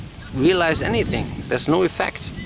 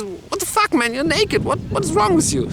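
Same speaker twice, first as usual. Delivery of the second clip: very high, rushed disbelief; acted flabbergasted outburst; shocked cab driver. Cab driver shocked at naked man.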